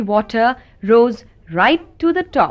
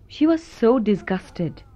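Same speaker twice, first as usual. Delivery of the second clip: usual speaking voice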